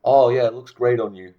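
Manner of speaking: mock an expected flattering reply; put-on agreeable praise; insincere compliment. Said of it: "they expect you to say".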